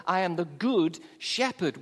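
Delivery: "good" carries the weight of "I am the good shepherd"; stress on good. Shown as a demonstration. Pastor contrasting Jesus with other shepherds, stressing "good". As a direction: press on "good" and let "shepherd" fall. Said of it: "with the emphasis on the word good".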